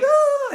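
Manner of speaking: very high, giddy squeal; imitated giggly squeal. Imitating a giggling woman on the train, giddy squeal. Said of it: "She's just giggling like".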